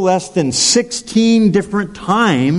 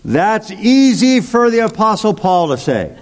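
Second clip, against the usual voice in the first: higher, doubting voice; imitating a skeptic; 6 semitones higher than usual. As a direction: voice the listener's mock objection. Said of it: "some of you may be saying".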